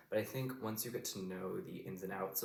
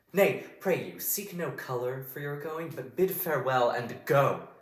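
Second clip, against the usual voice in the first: impassioned stage Shakespeare, slow and forceful; wronged queen, swelling to loud, high outbursts; Cleopatra's monologue; 4 semitones higher, 7 dB louder, slower than usual. Shown as a demonstration. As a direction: go right at your lover, betrayed and accusing. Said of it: "I'm gonna go through it once".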